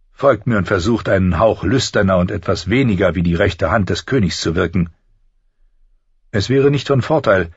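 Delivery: sly, teasing schemer with lifted pitch; Littlefinger. Acted. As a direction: needle him with playful mockery. Voices Littlefinger, mocking advice.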